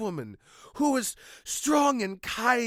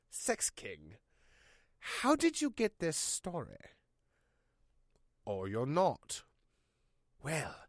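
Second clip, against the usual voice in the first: hushed, mock-ominous horror narration; ominous narrator; 4 semitones lower, 3 dB quieter than usual. Spooky narrator intro.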